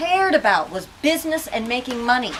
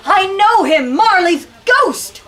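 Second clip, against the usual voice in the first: high, loud slow cry; flame crying out; 8 semitones higher, 11 dB louder, slower than usual. Acted. Teacher reading the flame crying out, high and loud. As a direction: cry it out in alarm.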